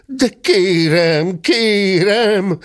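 high, drawn-out pleading; scared gentleman calling out high; frightened cry. A narrator's reading. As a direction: plead in alarm, stretching the words. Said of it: "kiáltotta ijedezve a tekintetes úr".

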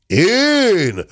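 high, indignant cry; outraged bellow. A narrator's reading. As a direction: yelp it in disbelief. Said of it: "ordított fel a téns úr".

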